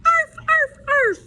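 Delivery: high, loud happy puppy yaps; dog barking. Acted. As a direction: yap like a laughing puppy. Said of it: "even Doggy".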